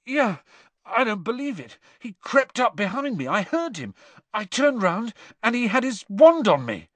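high, breathless shaken speech; panting. A narrator's reading. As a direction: talk while out of breath. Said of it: "panted Cedric".

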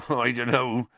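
amused, chuckling doubt. A narrator's reading. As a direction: chuckle as you disagree. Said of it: "chuckled Hagrid".

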